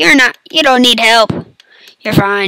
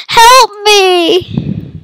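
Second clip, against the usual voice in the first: high, louder panicked yelp; mock cry for help; 9 semitones higher, 4 dB louder than usual. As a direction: squeal for help like a victim.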